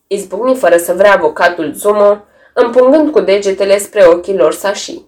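plain reading voice

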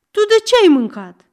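high, loud, fast furious yell. Acted. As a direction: bellow the accusation. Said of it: "zbiară Aron". (răcnet furios, sus și repede)

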